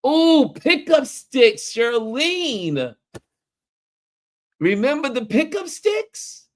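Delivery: high, delighted ooh over pickup sticks; light up at the memory; excited. Real, not acted. Host greeting chat remembering pickup sticks, delighted.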